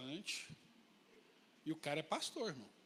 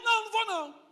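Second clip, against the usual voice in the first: shouted refusal; 10 semitones higher, 3 dB louder, faster than usual. Retold or imitated. Quarrel over vineyard peaks.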